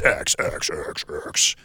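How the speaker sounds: low, soft, slow repeating echo; mock echo effect. Host hyping the accurate Foley, mock echo effect. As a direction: echo the last letter away, slowly. Imitated echo after "four D X.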